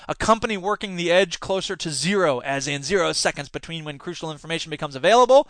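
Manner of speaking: usual speaking voice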